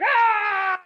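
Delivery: high loud battle cry; a mock fighting scream; fierce yell. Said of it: "I was like".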